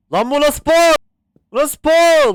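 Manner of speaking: high, loud disbelief; shocked yell. Real, not acted. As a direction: shout in disbelief. Shocked at odd character. (şaşkınlıkla yüksek bağırış)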